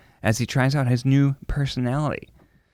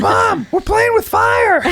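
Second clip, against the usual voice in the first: put-on much higher child shout, slow; kids shouting; 7 dB louder, slower than usual. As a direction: yell it like a gleeful little kid. Dad voicing kids calling mom.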